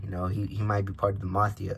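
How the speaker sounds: ordinary delivery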